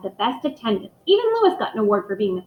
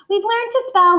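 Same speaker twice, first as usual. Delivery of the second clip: put-on child reciting; 11 semitones higher, 6 dB louder than usual.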